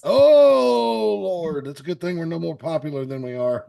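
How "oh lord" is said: surprised. Real, not acted. Mock shock at blasphemy.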